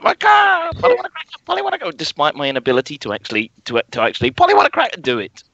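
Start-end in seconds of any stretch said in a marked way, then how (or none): 0.0-2.0 high squawky parrot call. Acted. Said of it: "not very good at imitating bird calls". 4.3-5.0 high squawky parrot call. Acted. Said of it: "imitating bird calls".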